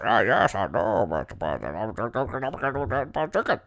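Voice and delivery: mumbling low, soft impression; mock a mumbling politician, words dissolving into mush; very low, quiet jabbering that climbs at the end; Mitch. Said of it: "That's how he talks".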